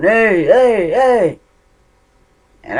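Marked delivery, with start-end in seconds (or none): 0.0-1.4 loud, high calls of hey; yelling. Retold or imitated. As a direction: call out hard through a door at night. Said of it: "started yelling".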